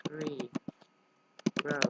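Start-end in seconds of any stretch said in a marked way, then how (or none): none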